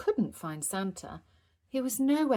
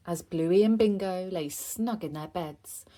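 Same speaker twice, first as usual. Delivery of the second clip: usual speaking voice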